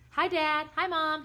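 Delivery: put-on high, loud boyish greeting; cheerful. A narrator's reading. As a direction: sing out a cheery hello. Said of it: "he said cheerfully".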